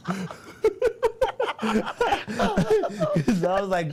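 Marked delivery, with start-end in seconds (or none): 0.3-1.6 big high laugh bursting out; burst of laughter. Real, not acted. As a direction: laugh loud at the memory. Laughing at his own fight story.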